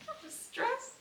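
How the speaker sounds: squeaked out high through a giggle, half laughing. Real, not acted. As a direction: let the stress come out as a high, giggly squeal.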